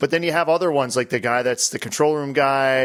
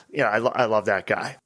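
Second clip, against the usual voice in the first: ordinary talk, nothing marked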